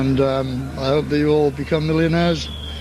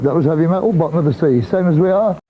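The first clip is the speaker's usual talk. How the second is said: ordinary talk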